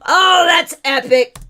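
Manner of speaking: high and loud through laughter; laughing. Real, not acted. Host delights at the beer clearance, laughing. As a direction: gush through the giggles.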